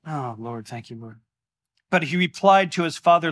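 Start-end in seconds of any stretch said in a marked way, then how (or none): 0.0-1.2 much softer and lower, breathed thanks; hushed prayer aside; quiet prayer. Real, not acted. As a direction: murmur a private thank-you to God. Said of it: "quiet prayer aside".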